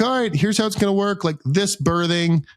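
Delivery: high-pitched imitation of an organizer briefing a group; mock instructions. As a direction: pitch it up like someone announcing the procedure. Imitates staff announcing boarding procedure.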